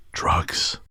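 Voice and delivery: hushed conspiratorial whisper. Retold or imitated. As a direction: lean in and breathe the word. Waiter leans in confidentially.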